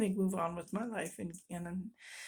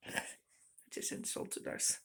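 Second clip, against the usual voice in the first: whispered aside; 10 dB quieter than usual. Real, not acted. Trailing regretful aside.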